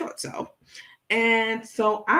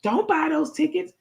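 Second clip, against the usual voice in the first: frantic warning; 9 semitones higher than usual. Retold or imitated. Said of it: "furious and frantic too".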